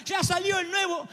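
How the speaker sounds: put-on high wailing shout of a spoiled child; crying tantrum. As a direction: bawl it out like a kid denied a toy. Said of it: "y te dice".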